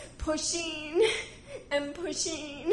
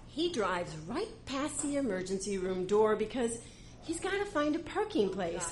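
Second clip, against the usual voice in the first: usual speaking voice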